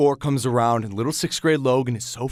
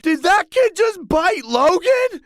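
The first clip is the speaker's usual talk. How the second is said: much higher, stunned question; shocked crowd; 14 semitones higher, slower than usual. Retold or imitated. Onlookers shocked at the bite, high pitched. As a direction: gasp it out like onlookers. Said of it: "Everyone's like".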